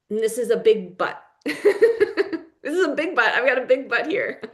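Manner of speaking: laughing through the joke; giggling at her own big but joke. Real, not acted. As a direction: chuckle out the pun, pitch jumping up. Joking about a big but.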